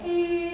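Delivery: long, held high horn blare; one piercing, unbroken horn tone; car horn imitation. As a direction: imitate a stuck car horn with a steady "eee". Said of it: "all I heard was".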